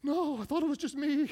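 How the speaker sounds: put-on high, surprised kid voice; mimicking his young self answering mom; relieved little boy. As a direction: sound like a boy who just learned he's normal. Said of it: "I was like".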